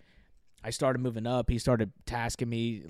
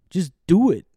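much louder, higher mock command; imitated barked order; 6 semitones higher, 12 dB louder than usual. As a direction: bark it like a drill-field sergeant. Sergeant barking, overheard, retold.

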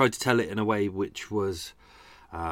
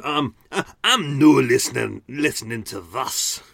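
mock-indignant Scottish listener; Scottish accent; 2 semitones higher, 6 dB louder than usual. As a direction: put on a broad Scottish accent in protest. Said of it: "all my Scottish listeners would have gone".

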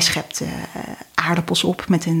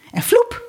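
magic whoosh; 14 semitones higher than usual. A narrator's reading. Magic sausage appears.

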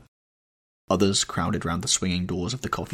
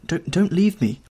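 desperate plea; 9 semitones higher, 4 dB louder than usual. Acted. Sybil crying out as Dorian turns to leave, desperate. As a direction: voice climbing high into a desperate cry.

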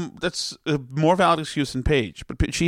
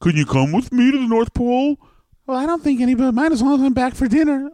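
sing-song Frosty cheer; 8 semitones higher, 5 dB louder than usual. Acted. Voices Frosty character.

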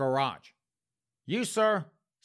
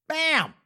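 loud high "bam"; cartoon punch shout; punch sound; 13 semitones higher, 6 dB louder than usual.

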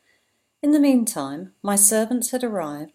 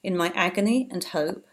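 plain reading voice

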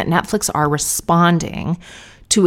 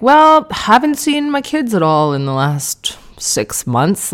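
drawn-out, higher mock celebrity voice; mock celebrity boast; 5 semitones higher, slower than usual. Mocking male celebs who brag they never see their kids, lightly voiced. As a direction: lightly mimic a self-pitying famous dad. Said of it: "male celebs just being like".

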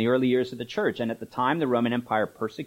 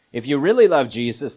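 louder, fervent evangelical preacher; mock preacher fervour; 8 dB louder than usual. Preacher imitating evangelical preachers, louder and fervent. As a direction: push it like a revivalist.

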